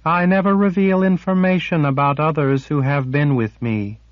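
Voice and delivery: high, aloof and remote; cold and distant. A narrator's reading. Sliff refusing Kara, cold and distant. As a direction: shut them out coldly. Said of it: "voice turned cold and distant".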